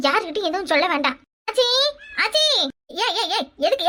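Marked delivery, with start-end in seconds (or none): 1.5-3.5 put-on urgent child's call; calling out loudly. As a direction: call through the house for help. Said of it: "எதுக்கு இப்ப கத்திக்கிட்டு வர?".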